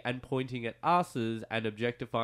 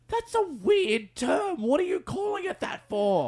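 high, loud mock outrage; incredulous squeal; 3 dB louder than usual. Host mocking the rapper's weird term, incredulous high shout. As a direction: shriek it like you can't believe it. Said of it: "I'm just like".

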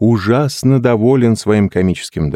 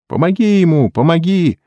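chorus of pleading; 11 semitones higher than usual. A narrator's reading. Friends urging the hypnotist to help, high cries. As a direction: cry out like an eager group. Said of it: "сказали все хором".

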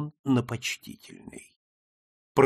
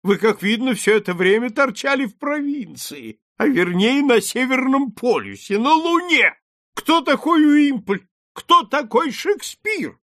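much higher, louder outraged disbelief; the lord scolds his friend for ignorance, much higher and louder; exclaiming; 13 semitones higher, 7 dB louder than usual. A narrator's reading. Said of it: "воскликнул лорд". (возмущённо, гораздо выше и громче)

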